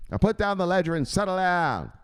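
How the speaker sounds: high, firm mock command; scolding boss. Boss ordering underling off the ledger, scolding.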